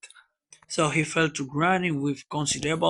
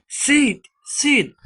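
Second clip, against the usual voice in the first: raised, high urgent call; 10 semitones higher, 6 dB louder than usual. Acted. Tom tries waking his sleeping brother, calling out. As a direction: call sharply to wake someone. Calls to wake sleeping Sid.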